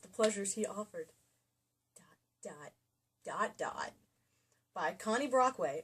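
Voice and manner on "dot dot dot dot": breathy teasing whisper, trailing off; mock seductive hush; sultry whisper. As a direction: whisper the dots suggestively. Reads the ellipsis suggestively.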